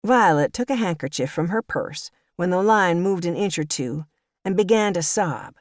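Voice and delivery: usual speaking voice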